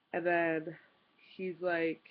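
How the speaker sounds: usual speaking voice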